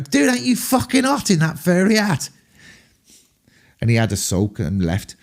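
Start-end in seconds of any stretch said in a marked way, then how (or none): 0.0-2.3 high mock yell across a room; much higher, calling out cheekily; retold shout across room. Teasing a rock star across the room, high mock yell. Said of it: "I just shouted across the room".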